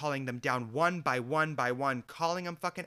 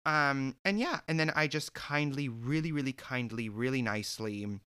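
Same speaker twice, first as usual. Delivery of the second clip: ordinary delivery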